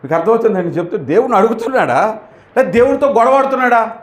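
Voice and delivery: usual speaking voice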